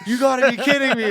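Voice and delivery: high, loud shout of astonishment; amazed disbelief. Retold or imitated. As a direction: play a dad blown away by the news. Acts out dad's amazed reaction.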